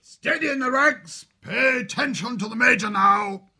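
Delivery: high, loud parade-ground bellow; officers' roar. A narrator's reading. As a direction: roar the mob to attention. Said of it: "The roars of the three officers froze the crew".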